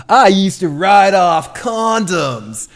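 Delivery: slow, stilted bad actor delivery; mock a wooden line reading; bad acting read. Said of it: "one of the worst acting reads".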